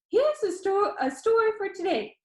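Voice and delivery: high, bright-voiced video host; upbeat YouTuber. A narrator's reading. YouTuber Danny asks the viewer, upbeat and high. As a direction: pitch it up like an eager vlogger.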